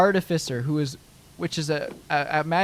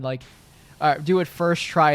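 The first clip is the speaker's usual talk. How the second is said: ordinary delivery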